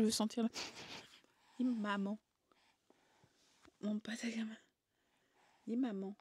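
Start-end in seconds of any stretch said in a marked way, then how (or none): 1.6-6.1 soft whisper. Real, not acted. Coaxing baby softly.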